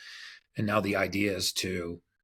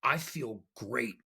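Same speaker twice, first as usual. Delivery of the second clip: savoring relief; 3 semitones higher, 4 dB quieter, much slower than usual. Retold or imitated. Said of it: "He said".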